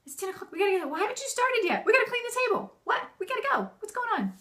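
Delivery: high, frantic hurry-up nagging; acted exasperated morning nag; nagging mother. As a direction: pester them in a high, urgent voice. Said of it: "Instead of saying".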